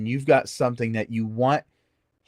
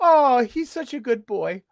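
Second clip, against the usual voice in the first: proud mom; 8 semitones higher, 3 dB louder than usual. Retold or imitated. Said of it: "And she goes".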